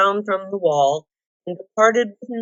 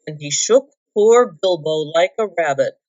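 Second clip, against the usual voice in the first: usual speaking voice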